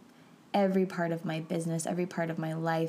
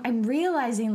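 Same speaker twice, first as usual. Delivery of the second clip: ordinary talk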